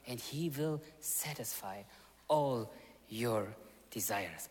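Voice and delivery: normal talking voice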